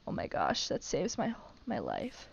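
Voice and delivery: soft, half-whispered relief; under-the-breath relieved gasp; hushed relief. Real, not acted. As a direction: say it low, as if quietly saved. Relief at freeing mail space.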